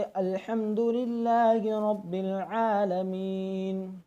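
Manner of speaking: slow recitation, last vowel stretched; chant, lengthening before the stop; recitation with madd. Shown as a demonstration. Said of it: "मद आरीज हो जाएगा". (मद आरीज़ के साथ तिलावत)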